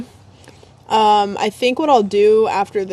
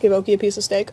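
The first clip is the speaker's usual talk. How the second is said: ordinary talk, nothing marked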